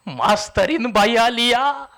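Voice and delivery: drawn-out announcement, slow, rising on या; slow reveal. Acted. Villager slowly revealing a lady teacher came, drawn out. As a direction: savour the news, slowly.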